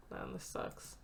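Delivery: whispered under the breath; whispered dismay. Acted. Player reacting to the bad reviews, whispered and dejected. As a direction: breathe it out defeated.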